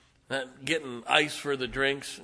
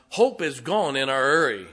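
normal talking voice

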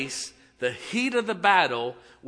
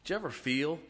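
normal talking voice

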